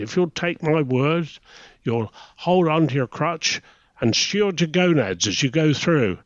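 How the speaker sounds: sing-song Welsh lilt, mimicking the lecturer; Welsh accent. Welsh instructor lecturing young submariners, in a mock Welsh accent. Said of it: "you've got to do a Welsh accent for this one".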